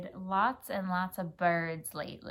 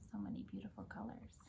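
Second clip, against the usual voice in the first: awed hush; 12 semitones lower, 15 dB quieter, much faster than usual. A narrator's reading. Wonder while reading.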